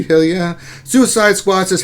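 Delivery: ordinary talk, nothing marked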